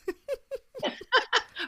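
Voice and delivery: bursting into a high laugh; a quick bright laugh. Real, not acted. Laughing at own joke.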